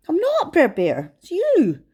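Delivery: lightly voiced bear, defensive; Brer Bear protesting. Brer Bear denies to Fox.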